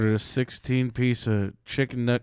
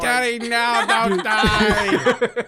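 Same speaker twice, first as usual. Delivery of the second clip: mock kid plea; 9 semitones higher, much slower than usual. Voicing the scared kid.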